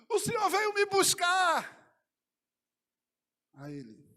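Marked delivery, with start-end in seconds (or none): 0.1-1.7 high, loud, drawn-out cry; pleading cry. Retold or imitated. Man crying out to Jesus in a vision, loud plea. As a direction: call out imploringly at full voice.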